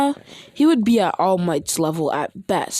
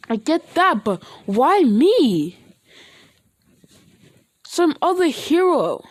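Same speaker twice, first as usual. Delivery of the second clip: high, pleading voice near tears; about to sob; 9 semitones higher, 3 dB louder than usual. Acted. Deku pleading with the villains, close to sobbing. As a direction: beg why it has to be him. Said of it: "he starts to sob".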